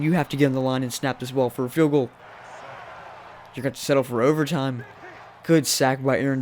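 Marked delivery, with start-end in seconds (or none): none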